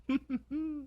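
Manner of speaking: sly laugh. Acted. Dragon after tempting question.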